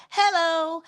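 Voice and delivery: high sing-song hello matching a door chime; high chiming greeting. Retold or imitated. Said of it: "I match the tone of the door".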